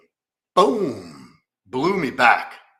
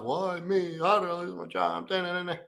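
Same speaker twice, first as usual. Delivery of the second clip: higher, thin mock whimper; self-pitying whine; 7 semitones higher, 4 dB quieter than usual. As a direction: whine it like a sorry-for-yourself sulk. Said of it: "I'm feeling sorry for myself".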